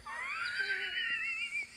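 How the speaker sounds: real high-pitched laugh; high burst of laughter. Real, not acted. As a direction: laugh out in disbelief. Laughing at the absurd scene.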